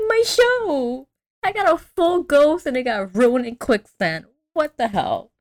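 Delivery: high wail easing down to an indignant finish; hammed-up lament, starting very high; mock devastated. Daphne losing her footage in quicksand, mock devastated. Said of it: "and she's devastated".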